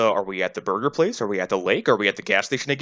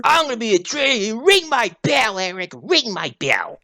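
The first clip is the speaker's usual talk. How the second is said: mock yelling; 10 semitones higher than usual. Co-host joining in mocking the character's tantrum, mock yelling. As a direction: stretch out the whiny holler. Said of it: "and then just starts yelling".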